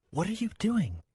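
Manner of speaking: ordinary delivery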